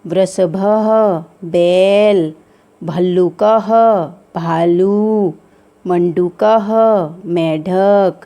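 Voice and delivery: slow, drawn-out word-by-word reading; demonstrating pronunciation. Shown as a demonstration. Teacher reads picture words to children, slow clear pronunciation. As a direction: stretch each word, pausing between items. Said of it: "पदों का उच्चारण".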